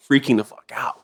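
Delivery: ordinary delivery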